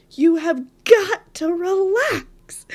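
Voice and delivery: high, loud, slow and firm, like a bossy know-it-all; Hermione. Retold or imitated. The calm part of her ordering the panicking part to relax, mock stern. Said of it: "Hermione from the bottom that's like".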